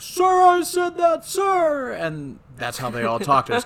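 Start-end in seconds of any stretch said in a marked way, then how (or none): 0.0-1.9 much higher, loud barked reply; mock recruit shout. Host imitating Joker answering the drill sergeant, mock shout. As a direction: shout it back at attention. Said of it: "And Joker's like".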